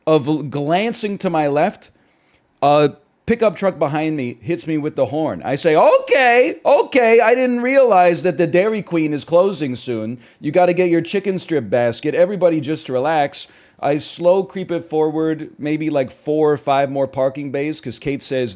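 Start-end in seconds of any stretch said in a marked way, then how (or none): 5.8-13.5 high, loud mock-exasperated outburst, easing as it goes; indignant comeback to a honking driver; exasperated retort. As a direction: start on two loud high okays, then settle into sarcasm. Acted retort to honking pickup driver.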